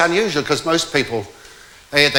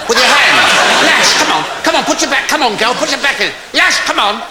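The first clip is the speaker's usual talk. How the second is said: high, loud comic egging-on; mock-frenzied commands, pitched high; frantic urging; 11 semitones higher, 6 dB louder than usual. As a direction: drive them on like a hammed-up excited coxswain. Husband urges wife to make waves.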